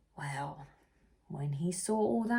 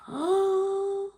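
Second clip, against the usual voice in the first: a high, sudden gasp of realisation; sharp gasp; 14 semitones higher, 5 dB louder than usual. Acted. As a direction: gasp as it dawns on you. Amelia realises the truth.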